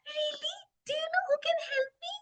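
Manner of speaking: very high, quick little-elephant squeal; excited. Acted. Boo Boo asking the birdie for help, excited child voice. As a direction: ask it high and fast, thrilled. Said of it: "Boo Boo was excited".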